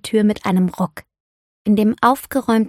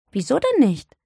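curious, higher-pitched question; neugierig; 5 semitones higher than usual. A narrator's reading. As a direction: ask it with open curiosity. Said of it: "fragte Sarah neugierig". (neugierig nachgefragt)

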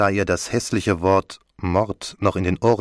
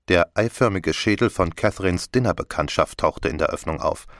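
ordinary delivery, nothing marked

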